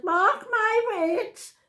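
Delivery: high, slow, drawn-out warning; emphatic prediction. Retold or imitated. Nan insists on red hair to granddaughter, emphatic and high. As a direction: sing it out high, stretching every word. Acted prophecy to her granddaughter.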